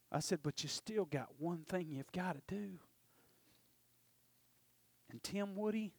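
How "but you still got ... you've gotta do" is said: whispered, softer bargaining; a hushed private plea. Real, not acted. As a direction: breathe it to God under your breath. Quiet aside to God.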